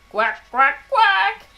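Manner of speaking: duck quacking. Shown as a demonstration. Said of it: "what sound do ducks make".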